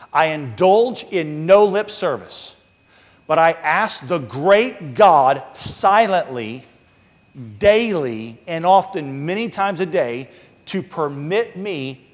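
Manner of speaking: loud, slow preaching read; preaching quote. Acted. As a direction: proclaim each phrase slowly. Reading Carver quote with force.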